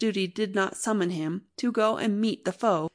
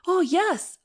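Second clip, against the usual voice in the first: high, eager cut-in; joyful; 10 semitones higher, 4 dB louder than usual. Acted. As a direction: burst in with hope. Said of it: "I joyfully broke in".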